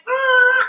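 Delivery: high, loud lifting yell; effort yell. Retold or imitated. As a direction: re-enact a roar of effort. Imitates yell while lifting.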